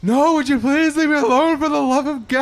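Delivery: high, loud laughing plea; Chris Farley exasperated. Retold or imitated. Farley pleading from bed, laughing while yelling. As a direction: beg to be left alone, cracking up. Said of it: "He was like".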